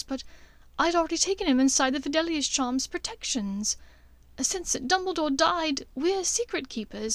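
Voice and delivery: Hermione explains, afraid of doing harm; worried Hermione. Acted.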